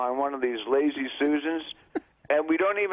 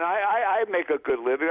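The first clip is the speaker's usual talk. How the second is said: ordinary talk, nothing marked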